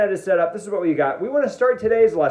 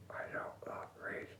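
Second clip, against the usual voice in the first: hushed whisper, very soft and slow; barely voiced, comic confession; 24 dB quieter, slower than usual. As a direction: whisper it as a secret aside. Confides the truth after pretending.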